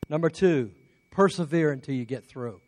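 ordinary delivery

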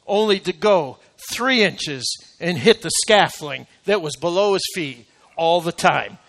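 usual speaking voice

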